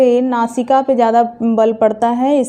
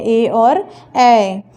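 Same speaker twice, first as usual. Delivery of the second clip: slow vowel demo; much slower than usual. Shown as a demonstration. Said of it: "teacher demonstrates the vowels".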